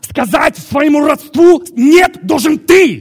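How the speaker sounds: high, full-force preacher's shout; impassioned preaching. Real, not acted. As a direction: shout the demand at the congregation.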